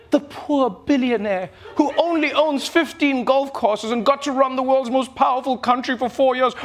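ordinary delivery

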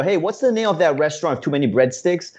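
ordinary delivery

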